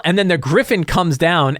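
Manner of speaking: ordinary delivery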